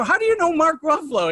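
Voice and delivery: high, loud mock disbelief; incredulous shout. To Barry, asking how he knows Ruffalo: loud incredulous mock shout.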